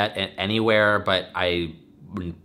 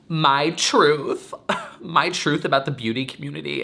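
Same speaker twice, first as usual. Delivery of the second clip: mock sobbing; 6 semitones higher than usual. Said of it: "and just me sobbing".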